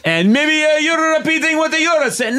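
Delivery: a clumsy Italian echo of the insult; bad Italian accent. Michelangelo parrots the insult in a bad Italian accent. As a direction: repeat it back in a hammy fake Italian. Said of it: "You're just repeating what I'm saying".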